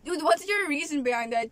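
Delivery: ordinary talk, nothing marked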